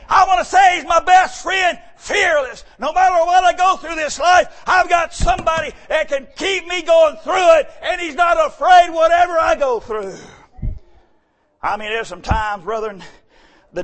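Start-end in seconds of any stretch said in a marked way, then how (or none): 0.1-10.1 loud, high, rising preacher's cry; preaching at full force. Acted. Preacher shouting his praise of his best friend to the church. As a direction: preach it out hard to the congregation.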